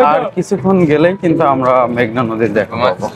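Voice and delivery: usual speaking voice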